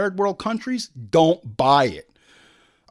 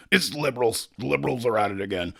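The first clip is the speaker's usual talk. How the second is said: mock Alex Jones; 5 semitones lower than usual. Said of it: "this has just turned into an Alex Jones podcast".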